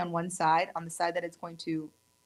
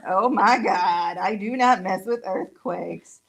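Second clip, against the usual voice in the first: emphatic outburst; 11 dB louder, slower than usual. Real, not acted. Earthquake scare, emphatic.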